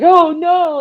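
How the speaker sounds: high, loud mock cry of dismay; mock alarm. Courage waking from a faint, mock alarm. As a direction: play a startled cartoon dog. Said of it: "he's like".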